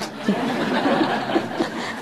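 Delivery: soft airy laugh at the punchline; breathy laugh. Real, not acted. Teller laughing at own punchline, soft airy laugh.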